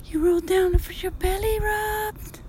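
high cooing pet voice. Real, not acted. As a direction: coo at the kitty. Talking sweetly to a cat.

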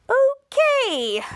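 high, stretched mock-awkward okay; uncomfortable. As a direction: imitate someone uneasy, pitch shooting up then falling. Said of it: "getting uncomfortable".

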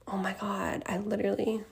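shocked whisper. Real, not acted. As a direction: whisper it in disbelief. Still shocked at the burn.

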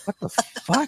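high, soft, baffled reaction; retold disbelief. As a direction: let the voice jump up in hushed disbelief. His retold reaction to the show.

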